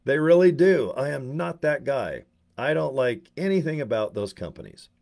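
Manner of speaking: ordinary talk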